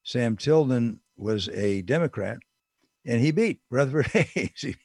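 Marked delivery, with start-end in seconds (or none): none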